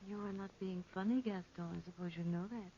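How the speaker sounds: soft, clipped-back reproach under the breath; hushed, icy reproach; cold quiet rebuke. Acted. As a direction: scold him in a hush.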